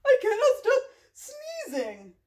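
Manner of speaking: high, loud, slow lament; distressed Gerald. Acted. Gerald laments that he keeps sneezing, distressed. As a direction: wail it in character.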